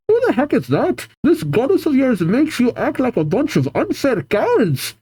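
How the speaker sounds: Scottish lass shouting in outrage; Scottish rage. Acted. Casey shouting at the grunts in an angry Scottish accent. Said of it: "Again, Scottish rage".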